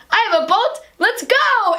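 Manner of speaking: high, eager call to set off; excited rallying cry. Retold or imitated. Fisherman calls the islanders to sail through the storm, high excited cry. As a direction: rouse the crowd like a proud fisherman.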